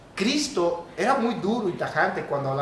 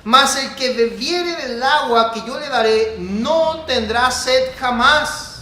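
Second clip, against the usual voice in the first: slow, loud, high bible recitation; solemn scripture reading; 5 semitones higher, 7 dB louder, slower than usual. Acted. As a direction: proclaim the verse over the music.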